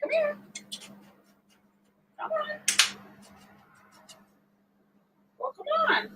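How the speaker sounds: high, sing-song coaxing call. Real, not acted. Calling her husband over during the prank, high coaxing voice. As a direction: lure him over sweetly.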